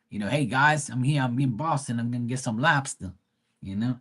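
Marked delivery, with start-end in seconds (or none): 0.3-3.1 casual Boston greeting with dropped R's; Boston accent. Retold or imitated. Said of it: "Bry probably has that".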